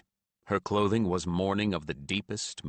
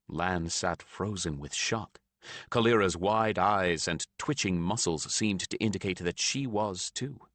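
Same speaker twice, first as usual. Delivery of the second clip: plain reading voice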